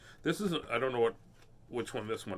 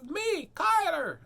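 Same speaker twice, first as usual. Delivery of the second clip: put-on high, slow little boy's voice; lightly voiced young son; child voice; much slower than usual. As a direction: sound like a small kid answering. Son's voice retold.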